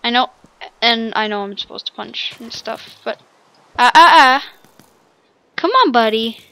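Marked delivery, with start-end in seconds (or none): 3.8-4.5 short loud rising "ah" yelps while being hit; panicked yelps. Real, not acted. Player getting knocked around, loud panicked yelps. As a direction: yelp in quick bursts.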